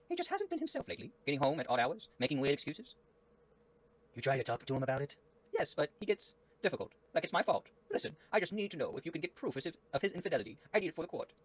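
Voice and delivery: high falsetto worried wife; squeaky, fast high woman's voice; Miss Green. Acted.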